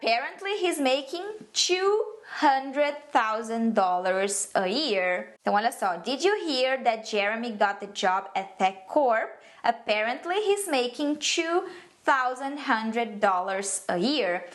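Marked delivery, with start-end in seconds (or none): 0.0-5.4 slow, clearly separated English phrases; careful example reading. Shown as a demonstration. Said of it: "ler aqui pra vocês entenderem melhor". 6.0-14.4 careful example reading. Shown as a demonstration. Second reading for learners.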